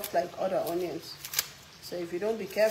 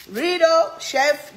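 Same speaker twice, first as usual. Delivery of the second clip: loud, high burst of excitement; excited; 7 semitones higher, 8 dB louder, much slower than usual. Real, not acted. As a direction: call it out, thrilled. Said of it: "reading comment excitedly".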